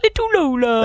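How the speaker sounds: mock cooing to a little dog; silly pet voice. Terrifying boss around his dog, imitated silly cooing. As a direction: imitate a tough boss gone soppy. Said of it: "You put on that silly voice".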